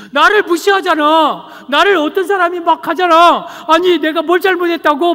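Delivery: loud, high-pitched protest; indignant outburst. Acted. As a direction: act out an upset child venting to a parent. Child's outburst at being mistreated.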